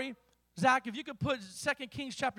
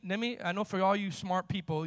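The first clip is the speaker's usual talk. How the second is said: ordinary talk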